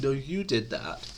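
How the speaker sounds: mock a child throwing blame back; childish retort. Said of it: "literally like talking to a child".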